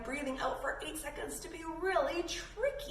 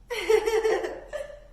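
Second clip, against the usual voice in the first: bright high giggle; 9 semitones higher, 7 dB louder than usual. Real, not acted. Teacher laughing with kids, bright giggle. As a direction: laugh out loud at the game.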